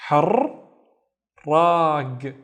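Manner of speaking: "Har, rag" with a rolled r in both syllables; "Har, rag" said loud and split at the doubled r. Shown as a demonstration. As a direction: hold the r on "Har", restart it on "rag". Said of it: "so the ra is doubled".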